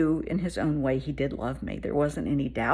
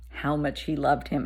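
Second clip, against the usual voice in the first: ordinary talk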